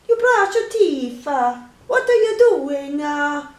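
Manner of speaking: loud, high put-on Italian accent. Trying to keep up the accent, mock-Italian voice. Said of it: "I can't do it all the time".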